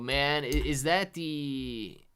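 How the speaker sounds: ordinary talk, nothing marked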